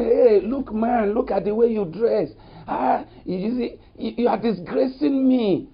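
high, sharp scolding of a partner; harsh scolding. Retold or imitated. Pastor mimics a partner mocking clothes, high and harsh. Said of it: "don't say".

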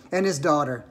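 plain reading voice

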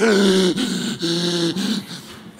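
a gasping breath, winded at altitude; exhausted gasp. Retold or imitated. Worn-out photographer at eight thousand feet, gasping. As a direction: gasp for air like you're out of breath. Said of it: "I'm exhausted".

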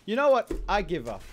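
loud, high burst of frustration; exasperated outburst. Real, not acted. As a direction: throw your hands up, fed up. Frustrated with tanky enemy.

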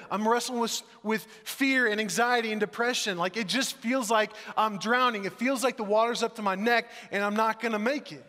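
anguished outcry. Retold or imitated. A preacher voicing his listener's despair, rising and loud. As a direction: pour out despair like you're drowning.

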